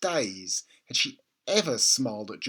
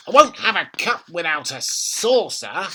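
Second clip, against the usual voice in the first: put-on higher, louder bossy old woman; bossy grandma; 5 semitones higher, 8 dB louder than usual. A narrator's reading. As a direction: order the boy about. Grandma voiced in read story.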